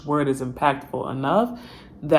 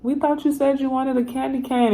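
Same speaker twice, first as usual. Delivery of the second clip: higher, mock puzzled spirit voice; spirits' voice; 9 semitones higher than usual. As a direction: play the confused spirit team. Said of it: "They're gonna be like".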